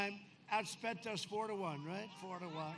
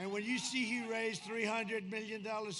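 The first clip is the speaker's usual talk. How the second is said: usual speaking voice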